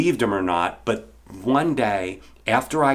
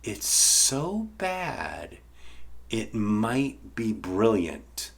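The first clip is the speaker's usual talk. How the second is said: friend's slow verdict; 7 dB quieter, much slower than usual. Retold or imitated. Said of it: "he goes".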